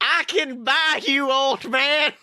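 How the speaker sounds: put-on high, slow smug kid voice; Gideon impression. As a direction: play the spoiled little villain, drawn out and high. Quoting Gideon in character.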